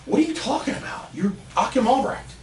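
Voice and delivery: high, disbelieving outburst; can't believe his hero says that; high-pitched shocked disbelief; incredulous. Retold or imitated. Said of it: "I'm like".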